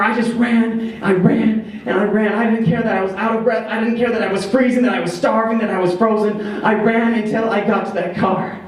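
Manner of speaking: fast, high, urgent telling; frantic, breathless. A narrator's reading. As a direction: rush it like running for your life. Frantic flight in the snow.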